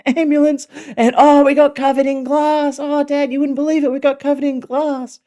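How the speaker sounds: high, loud, breathless-with-news child impression; excited kids. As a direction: imitate thrilled children bursting to tell Dad. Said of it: "they were really excited to tell me".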